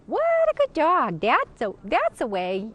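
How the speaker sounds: high-pitched, pleasant, loud praise for a dog; big high-pitched gush of approval. Shown as a demonstration. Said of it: "high-pitched and pleasant in intonation".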